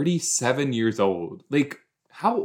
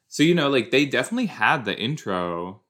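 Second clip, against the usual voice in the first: usual speaking voice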